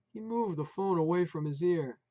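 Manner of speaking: usual speaking voice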